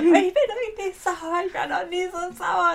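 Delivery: hammed-up high, loud wail of anger; Anna screaming down the phone. Co-host imitates furious friend on the phone, mock screaming. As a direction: stretch it out, imitating an outraged caller. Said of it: "schreit mir ins Telefon".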